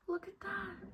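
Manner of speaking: high, soft, tiny voice; small lamenting voice. Real, not acted. Vlogger showing her broken snow globe, small lamenting voice.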